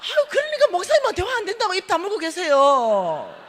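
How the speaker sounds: high, loud scolding cry; exasperated shout. Retold or imitated. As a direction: yell at him to hush. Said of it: "이러니까".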